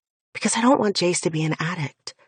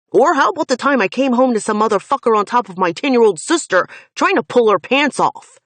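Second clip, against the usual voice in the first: higher, louder and fast; furious outburst; 5 semitones higher, 6 dB louder, faster than usual. Acted. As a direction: rush it out in rage. Finn erupts at worst memory.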